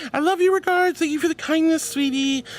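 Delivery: high, loud gushing; squealed thanks to a viewer. Real, not acted. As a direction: beam the love back.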